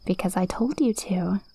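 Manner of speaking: soft, menacing whisper. Acted. Witch whispering a command to her spellbound captive, hushed whisper. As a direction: breathe the order into his ear.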